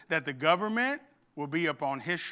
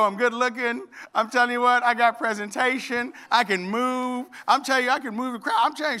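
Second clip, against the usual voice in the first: high, louder, fast mock bragging; boastful bragging; 7 semitones higher, 6 dB louder than usual. Pastor retelling bragging to his speech coach, mock boastful. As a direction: brag with hammed-up swagger.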